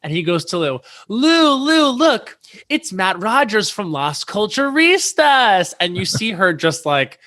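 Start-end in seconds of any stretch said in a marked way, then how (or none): 1.1-5.7 high, eager call to get her attention; calling out to Lou, thrilled; excited introduction. Retold or imitated. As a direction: director eagerly pointing Matt out to the star, high and excited. Ben excitedly introducing Matt to Lou.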